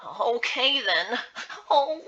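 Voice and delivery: put-on high-pitched, nervous young caretaker; skittish. Young caretaker answering Beth, skittish. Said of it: "the young caretaker skittishly replied".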